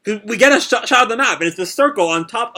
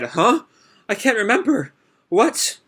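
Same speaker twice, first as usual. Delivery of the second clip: panicked confusion; 7 semitones higher than usual. Retold or imitated. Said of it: "breaks down in, like, a panic".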